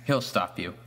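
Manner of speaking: ordinary delivery, nothing marked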